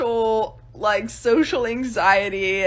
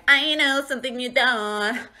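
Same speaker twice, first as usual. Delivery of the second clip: sly teasing voice, getting louder; smug secret; 3 semitones higher, 4 dB louder than usual. Retold or imitated. Caesar to his captors, smug and teasing. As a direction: gloat over a secret.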